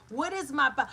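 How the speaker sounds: ordinary talk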